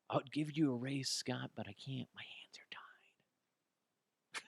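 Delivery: dropping into a helpless whisper; mock apologetic boss. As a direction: feign regret, then slow to a whisper. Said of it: "I used to have a boss that would do that".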